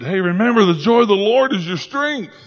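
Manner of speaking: high-pitched, rousing call; calling after them. Retold or imitated. Nehemiah to people leaving sad, calling out encouragement. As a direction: call out to lift departing listeners.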